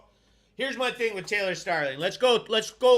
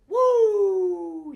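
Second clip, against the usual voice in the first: excited whoop; 10 semitones higher, 6 dB louder than usual. Real, not acted. Celebrating stars sent.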